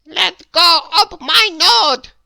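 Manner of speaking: high, loud cry of pain. A narrator's reading. Mister Mischief held by the nose, crying out. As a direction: yelp out while your nose is pinched. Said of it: "cried Mister Mischief".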